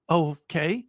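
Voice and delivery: mildly bemused, rising okay; unsure, as if weirded out; puzzled okay. Retold or imitated. Batter reacting to a shouting little girl, mildly puzzled. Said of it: "this kind of funny, sort of like".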